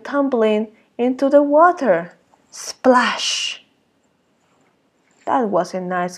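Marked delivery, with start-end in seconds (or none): none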